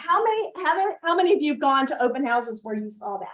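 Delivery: usual speaking voice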